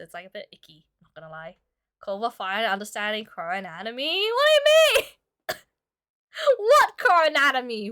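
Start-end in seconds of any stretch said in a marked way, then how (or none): 4.3-7.9 high startled squeak; surprised squeak. Real, not acted. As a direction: blurt it out, caught off guard. Surprised reaction to chat.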